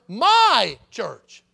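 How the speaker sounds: "My church" punched loud, stress on "My"; emphasized words. Shown as a demonstration. Pastor stressing the verse's next words to the congregation, loud. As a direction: land hard on "My" then "church". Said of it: "then what's the next word?".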